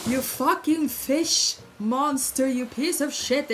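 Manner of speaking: imitating a drunk northern Norwegian man cursing at the police; drunk man yelling. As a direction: bellow it like a drunk being hauled off by cops. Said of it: "And he's like".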